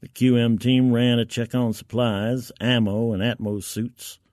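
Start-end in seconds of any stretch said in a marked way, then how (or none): none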